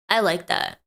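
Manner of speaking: a hint of a funny voice on the repeat; slightly put-on voice. Shown as a demonstration. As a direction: savour the line lightly in a voice.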